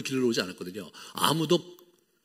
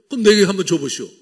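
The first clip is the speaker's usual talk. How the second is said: higher, louder taunt, falling hard on 내게; woman mocking Jesus' offer of water; sarcastic challenge; 6 semitones higher, 9 dB louder, faster than usual. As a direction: dare him sarcastically, stressing 내게. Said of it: "또 약간 이제 씹어 먹죠".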